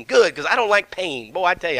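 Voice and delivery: usual speaking voice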